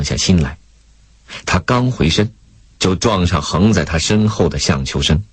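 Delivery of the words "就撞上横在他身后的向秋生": ordinary delivery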